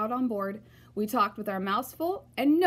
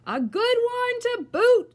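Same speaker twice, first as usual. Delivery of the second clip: high, slow crew echo; pirate crew agreeing; 13 semitones higher, 7 dB louder, slower than usual. A narrator's reading. Pirate crew agreeing with their captain, high and slow. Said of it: "the others agreed".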